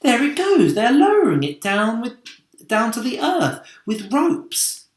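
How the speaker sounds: high, loud and eager, pointing at the sky; excited cry. A narrator's reading. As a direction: call it out in wonder. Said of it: "cried James".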